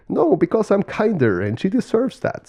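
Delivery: high-pitched bickering bird voice; arguing turkey. Acted. Turkey insisting to his peacock brother, high-pitched protest. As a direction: argue back in a high turkey voice.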